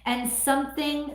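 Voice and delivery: usual speaking voice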